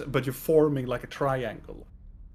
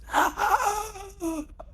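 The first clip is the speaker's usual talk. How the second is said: high, drawn-out sinister laugh; eerie villain laugh. Acted. Villain laughing inside the players' minds, eerie laugh. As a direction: laugh long and high like a haunting presence. Creature's laugh heard in the mind.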